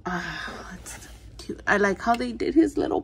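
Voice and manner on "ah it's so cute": whispered, melting delight; soft whisper. Real, not acted. Collector cooing at a toy, soft whisper. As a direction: breathe it out softly, smitten. Gushing over the figure.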